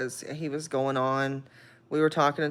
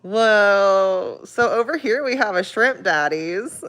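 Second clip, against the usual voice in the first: tour guide voice; 5 semitones higher, 6 dB louder, slower than usual. Retold or imitated. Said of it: "And I was like".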